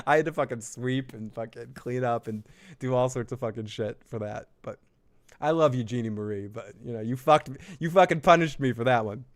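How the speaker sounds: normal talking voice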